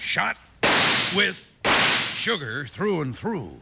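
each word drawn out with pauses; spaced-out slow catchphrase; slogan line. Acted. Cowhand reciting the slogan to his horse, drawn out. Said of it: "every time I say".

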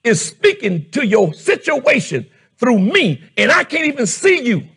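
loud, fervent preaching to the congregation; preaching at full force. Acted. Pastor preaching to the church, full-force shouting. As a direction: raise the voice and drive it home.

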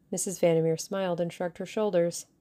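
ordinary delivery

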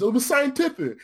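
high, pleading squeak of a voice; Strike's desperate answer. Retold or imitated. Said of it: "he says".

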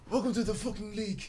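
put-on high, muffled voice with a light African accent; Joel Embiid impression. Friend imitates Joel Embiid's African accent for the group. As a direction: talk as if through a hand on the throat, from the next room. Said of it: "It's that African accent".

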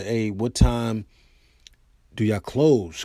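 normal talking voice

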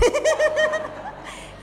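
high rising giggle; amused high-pitched laugh. Real, not acted. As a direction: burst into a high chuckle mid-story. Laughs telling the colleague's question.

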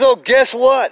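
high, loud announcement; retold big news. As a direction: burst out as the brother with news. Brother breaking news, retold.

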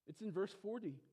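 normal talking voice

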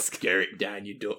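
put-on low Nottinghamshire accent. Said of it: "in a Nottinghamshire accent".

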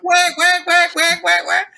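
high, loud whack imitating a string bend; guitar bend sound. Guitarist imitating his unison bends in a jazz solo, high loud whacks. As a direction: sing out the bent note as whack. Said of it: "I'm like".

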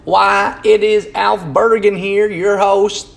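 high, slow hammed-up Southern country drawl; country accent. Vlogger hamming up a country host, slow hillbilly drawl. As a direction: put on a big folksy Southern host voice. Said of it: "apologies for the country accent there".

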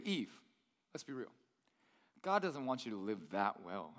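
low, soft confiding tempter; lightly voiced serpent. Serpent confiding to Eve, low soft sly voice. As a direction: lean in and whisper doubt low.

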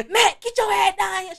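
high, slow, loud call. Retold or imitated. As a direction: summon sister downstairs. Calling sister while drunk.

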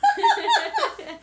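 high squealing laugh; loud laugh. Real, not acted. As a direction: burst out laughing. Laughing at friend's hiccups.

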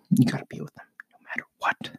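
hushed stern whisper; soft scolding aside. Real, not acted. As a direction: whisper the lecture to the absent dad. Lecturing absent father.

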